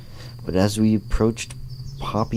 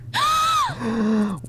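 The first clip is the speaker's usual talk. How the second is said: shocked gasp. Acted. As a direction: gasp in horror. Shock at being matched up.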